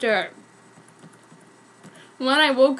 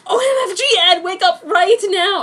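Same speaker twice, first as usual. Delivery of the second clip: much higher, louder alarm; screaming; 6 semitones higher, 8 dB louder than usual. Acted. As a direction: wake him in a panic. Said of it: "I screamed".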